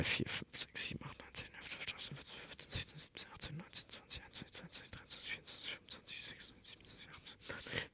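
whispered counting; whispering. Real, not acted. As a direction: count under your breath. Counting posts under his breath.

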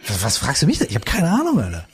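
deutlich höher, aufrichtig ratlos; innerer Beamter, aufrichtig. Retold or imitated. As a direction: den Beamten mit dem Aktenkoffer höher sprechen lassen. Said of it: "so aus tiefstem Herzen sagt". (Beamter schaut vom Aktenkoffer hoch, aufrichtig und höher)

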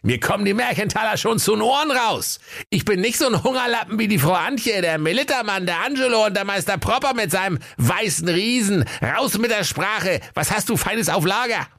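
high, comically enraged rich man; furious Kaiser. Acted. Kaiser answers shopkeeper's taunt, mock fury in high voice. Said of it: "ganz fuchsteufelswild und rief".

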